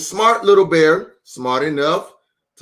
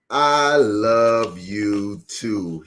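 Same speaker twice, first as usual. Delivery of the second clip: slow, low, gentle I love you too; tender reply; 5 semitones lower, much slower than usual. Acted. Daddy Bear stroking cub's head.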